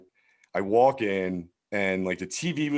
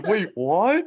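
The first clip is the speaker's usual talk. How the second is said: high-pitched, louder mock alarm; panicked cellmate; 10 semitones higher, 5 dB louder than usual. Startled cellmate learning about county, mock panic. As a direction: imitate a cellmate's alarmed disbelief.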